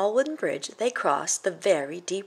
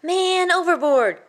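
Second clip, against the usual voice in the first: much higher, loud and drawn out; called out alarm; 12 semitones higher, 7 dB louder, slower than usual. Acted. Billy Bob calling the alarm as the troll goes under. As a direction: sound the alarm across the river. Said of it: "called Billy Bob".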